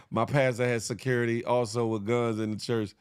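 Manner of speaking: ordinary talk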